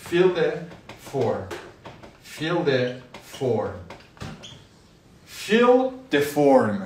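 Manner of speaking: very slow, drawn-out fill the form; slow model. Shown as a demonstration. Teacher drilling fill the form slowly for students. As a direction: stretch each word of fill the form. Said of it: "یعنی فرم پر کن".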